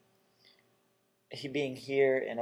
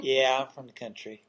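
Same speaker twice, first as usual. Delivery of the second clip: light North Carolina twang, proud answer; North Carolina accent; 5 dB louder than usual. Retold or imitated. Host answers his own question, mild North Carolina accent. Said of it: "that's my North Carolina accent coming out".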